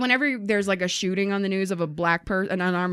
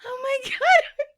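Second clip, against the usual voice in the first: high, teary laughter; helpless laughter; crying laughing; 3 dB quieter than usual. Real, not acted. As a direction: laugh until breathless. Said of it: "I'm dying".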